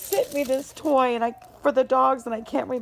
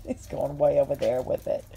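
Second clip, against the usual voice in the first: normal talking voice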